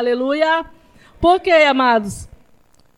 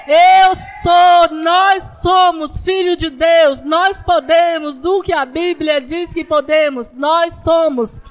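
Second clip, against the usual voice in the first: loud declaring preacher cry; preacher declaring, voice raised; preaching at full force; 2 semitones higher, 6 dB louder, slower than usual. Acted. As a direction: proclaim it to the church, loud and sure.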